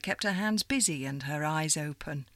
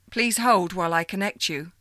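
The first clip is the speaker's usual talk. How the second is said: louder, formal hold-the-line voice; stiff caller voice; 7 dB louder than usual. Acted. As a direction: announce it like a switchboard. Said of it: "the voice said".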